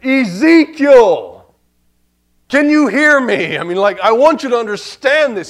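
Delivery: high, booming shout; very loud, high-pitched call with stretched-out words; screaming loud voice. Acted. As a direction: holler as if calling right into the prophet's ear. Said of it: "he's screaming with a loud voice".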